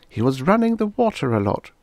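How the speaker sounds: put-on a small boy eagerly volunteering; little boy. A narrator's reading. Son telling his father what he heard, lightly voiced boy.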